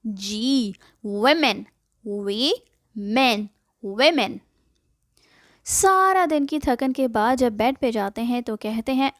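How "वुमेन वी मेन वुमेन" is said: वुमेन broken into वी and मेन, wimen; softer syllable by syllable. Shown as a demonstration. Teacher breaks the word down for learners. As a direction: spell out वुमेन, vi then men. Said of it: "सही pronunciation है वुमेन".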